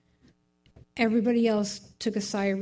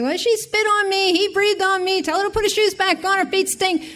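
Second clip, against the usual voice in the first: put-on high, louder squabbling child voice; whining kids; 9 semitones higher, 4 dB louder than usual. Kids squabbling in the car, mock high whine. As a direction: mimic bickering kids tattling. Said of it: "It was like".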